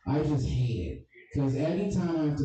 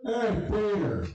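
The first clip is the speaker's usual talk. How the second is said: higher, slow, drawn-out mock voice; Puddy impression; 9 semitones higher, slower than usual. Comic's third bit, high slow goofy voice. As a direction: stretch it out high as a silly character. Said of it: "Here's Putty from Family Guy".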